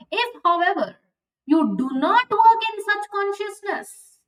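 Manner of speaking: ordinary delivery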